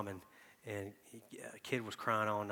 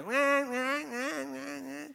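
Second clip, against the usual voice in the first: much higher mock infant crying; baby wail imitation; 6 dB louder, much slower than usual. Father imitating his crying baby, high wail. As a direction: cry like a newborn.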